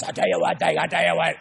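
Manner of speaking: lightly voiced pit trader; a hint of a gruff broker griping; mock trader's brag; trader talk. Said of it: "they all talk like this".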